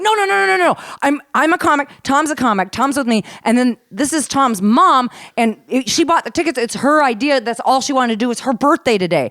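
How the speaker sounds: high, loud, tumbling explanation; acted-out anxious justifying; retold flustered plea. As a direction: plead your case in a rush of excitement. Retelling her flustered plea to manager.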